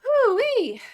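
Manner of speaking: high, loud hoo-wee; excited whoop. Real, not acted. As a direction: let out a gleeful whoop. Excited exclamation at butter.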